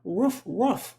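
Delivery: high, put-on little dog bark. Friend imitating her dog, a high mock bark. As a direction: yip it like a small dog. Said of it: "she was like".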